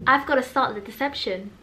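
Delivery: ordinary talk, nothing marked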